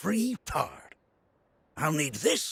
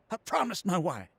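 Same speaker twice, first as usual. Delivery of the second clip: ordinary delivery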